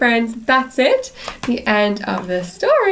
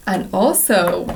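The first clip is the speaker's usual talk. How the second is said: ordinary delivery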